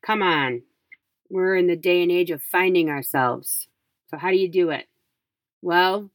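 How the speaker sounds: ordinary delivery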